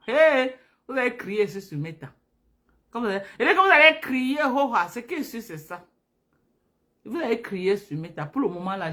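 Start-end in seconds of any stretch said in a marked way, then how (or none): 2.9-5.8 mock outcry. Mimics followers crying out online.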